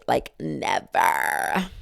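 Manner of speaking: a very slow low never; drawn-out never. Real, not acted. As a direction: stretch never out to the end. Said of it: "Never in a million years".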